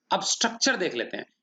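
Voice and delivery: ordinary talk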